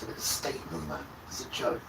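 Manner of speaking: scornful whispered aside. Real, not acted. Mocking modern Israel under his breath, whispered. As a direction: breathe it out as a sneer.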